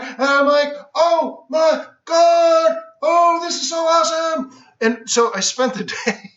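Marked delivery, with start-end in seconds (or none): 0.9-4.5 high, slow and drawn out delight; retold rapture on the trading floor; thrilled. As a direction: gush like a kid let in.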